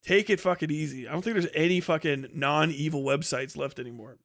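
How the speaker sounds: ordinary delivery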